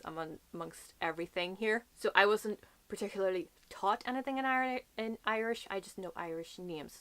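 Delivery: ordinary talk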